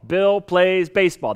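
Bill plays baseball said slowly as one phrase; a teacher's slow, clear model sentence; slow whole sentence. Shown as a demonstration. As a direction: read the sentence through slowly, Bill stressed. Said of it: "That's the way we structure".

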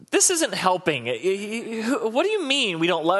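high, stammering protest; objecting couple. Retold or imitated. Couple protests the pastor's claim, high and flustered. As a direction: play the indignant fiancé. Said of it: "they look at me like".

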